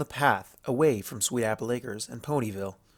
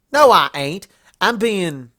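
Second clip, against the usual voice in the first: sharp, much louder denial; loud retort; 7 semitones higher, 9 dB louder, slower than usual. Acted. Applejack denying she is stubborn, loud. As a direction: fire back at once.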